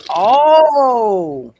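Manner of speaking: a big dawning "oh" that trails away; dramatic realization. Real, not acted. Reacts to a game stat reveal.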